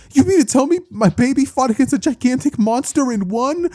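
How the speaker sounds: very high, disbelieving mom voice; astonished mother. Acted. Mother hearing of her son's fight, astonished. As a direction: squeal it in motherly amazement.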